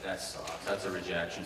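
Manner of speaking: quiet letdown after the call; glum. Retold or imitated. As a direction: mutter it, defeated. Assumes he was rejected.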